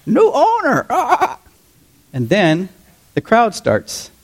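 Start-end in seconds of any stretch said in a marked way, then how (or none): 0.0-1.4 high, slow parrot squawk. Acted. Bar parrot heckling the church worship team, high squawk. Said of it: "and he goes".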